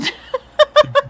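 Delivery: high shrieking laugh; loud burst of laughter. Real, not acted. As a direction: cackle out loud at it. Laughing at gross story.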